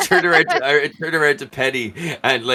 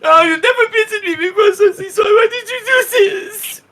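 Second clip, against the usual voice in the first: very high, loud crying character voice; betrayed wailing; 6 dB louder than usual. Acted. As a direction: squeal the reproach in mock anguish. Jacques wails at Sussy Son.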